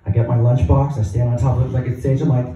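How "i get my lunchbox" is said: ordinary delivery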